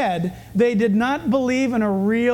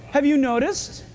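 ordinary talk

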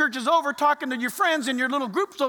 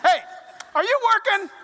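talking to the clock; 9 semitones higher, 4 dB louder than usual. Retold or imitated. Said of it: "see if my alarm clock's working".

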